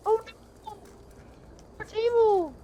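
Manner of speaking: high mock kid voice; meme quote. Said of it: "in the most deadpan voice".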